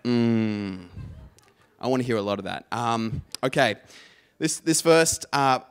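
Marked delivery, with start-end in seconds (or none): 0.0-0.9 long loud appreciative "mm"; half-orgasm, half-gospel grunt. Shown as a demonstration. Poet shows the audience how to respond, gospel-choir grunt. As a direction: moan it like a southern gospel choir member. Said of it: "half orgasm, half southern gospel choir".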